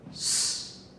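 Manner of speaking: s as a pure hiss, no vowel; isolated S sound. Shown as a demonstration. Teacher showing students the S sound, a bare sustained s. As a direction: make only the sound of s. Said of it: "Here we have the S sound".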